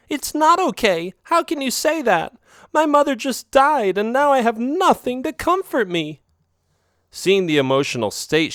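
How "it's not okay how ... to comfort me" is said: yelling. Acted. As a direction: shout him down, voice way up. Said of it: "She looked at me and yelled".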